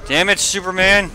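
high, loud burst of frustration; exasperated cursing. Real, not acted. Gamer cursing Superman mid-fight, exasperated. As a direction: curse your opponent out loud. Losing to Superman, yells at him.

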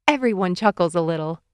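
plain reading voice

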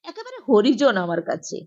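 usual speaking voice

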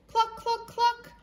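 squeaky high clucking; high hen clucks. Acted. Hen clucking from the mud, high and squeaky.

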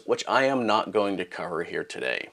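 ordinary talk, nothing marked

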